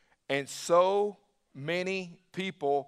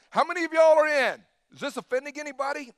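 challenging call; 6 semitones higher, 4 dB louder, faster than usual. Real, not acted. Preacher challenges congregation.

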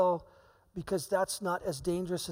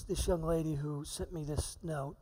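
ordinary delivery

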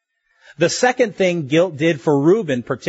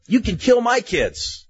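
higher, slow, rash offer in Reuben's voice; Reuben piping up; 8 semitones higher, slower than usual. Retold or imitated. Reuben pipes up to his father with a rash, slow offer. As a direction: blurt it out like a desperate son. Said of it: "Reuben pipes up and he says".

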